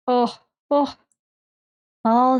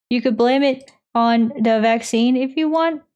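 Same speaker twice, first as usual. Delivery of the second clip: usual speaking voice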